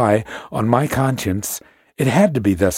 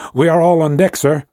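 higher and louder reply; crying out; 6 semitones higher, 6 dB louder than usual. A narrator's reading. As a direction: shout back from the deck. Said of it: "Several voices cried out together".

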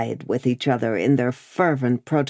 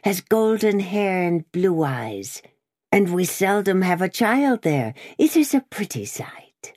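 rising higher into a whispered relish; meditative relish; 6 semitones higher than usual. Acted. Jacques Three dreaming of the guillotine, rising to a relishing whisper. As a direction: savour it, ending in a whisper. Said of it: "with a meditative enjoyment of his words".

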